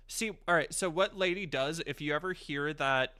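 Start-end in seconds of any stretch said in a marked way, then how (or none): none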